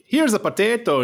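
hammed-up fake Irish, pitched well up; terrible Irish accent. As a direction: put on a bad Irish accent. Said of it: "That was a terrible accent".

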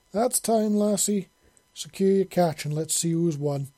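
loud, hearty call. A narrator's reading. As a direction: call time across the water to a partner. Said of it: "Monty called".

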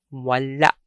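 "wala" with a short last A cut off by a glottal catch; final glottal stop. Shown as a demonstration. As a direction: chop the end of "wala" in the throat. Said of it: "short vowel with a stop".

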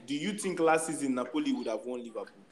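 ordinary talk, nothing marked

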